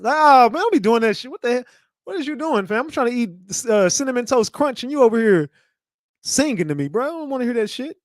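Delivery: outraged protest. Acted. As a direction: push him away with your voice. Acts annoyed at singing roommate.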